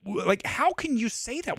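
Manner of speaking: what did you just say, aghast; incredulous. Retold or imitated. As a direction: drag it out in disbelief. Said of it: "You're like".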